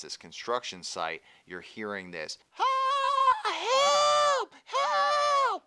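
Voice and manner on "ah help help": high, loud scream for help; cry for help. Acted. GM voices someone off at the construction site, screaming for help. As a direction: shriek it out, drawn out. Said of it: "I hear a cry for help".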